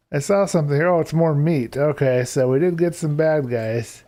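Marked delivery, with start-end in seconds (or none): none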